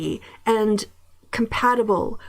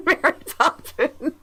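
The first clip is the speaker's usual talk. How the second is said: higher, laughing film title; 5 semitones higher than usual. Real, not acted. Host amused by a bad accent, laughing. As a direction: say it through a laugh.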